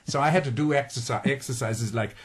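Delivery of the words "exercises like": usual speaking voice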